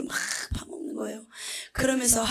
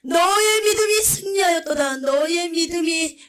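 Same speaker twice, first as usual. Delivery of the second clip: high, loud, exultant proclamation from the throne; proclaiming a blessing over a martyr, high and loud; joyful voice of Jesus; 6 semitones higher, 10 dB louder than usual. Retold or imitated. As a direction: boom out the blessing like a rejoicing king. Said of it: "너무나 기쁜 음성으로".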